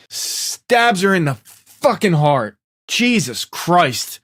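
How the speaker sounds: "Stabs" and "fucking" drawn out slowly, pitch lifted; slow, heavy stress on "fucking heart" and "Jesus Christ"; upset emphasis. Real, not acted. Recapper upset at the stabbing, "Jesus Christ" slowed for emphasis. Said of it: "I was so upset".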